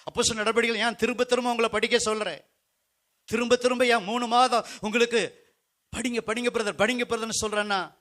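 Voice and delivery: high-pitched, insistent exhortation; urgent preaching. Real, not acted. Preacher urges brothers to read Acts, urgent preaching. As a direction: push the listeners hard to take this in.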